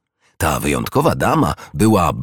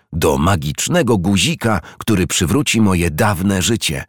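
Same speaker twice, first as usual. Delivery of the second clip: usual speaking voice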